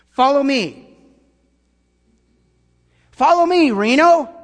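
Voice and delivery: loud, high grandstanding call; self-serving pastor. Pretend. Pastor mocking himself calling the city to follow him, loud. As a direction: play a preacher building his own name. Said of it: "If I say".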